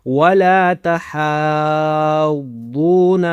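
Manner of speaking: ولا تحاضون with the "haa" held very long; elongated recitation. Shown as a demonstration. Teacher modelling the madd, ولا تحاضون drawn right out. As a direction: chant ولا تحاضون slowly, stretching "haa". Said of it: "ہا کو کھینچنا ہے".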